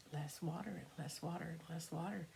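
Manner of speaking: trailing off into a hush; fading away. A narrator's reading. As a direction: let it dwindle to almost nothing. River drying up, fading.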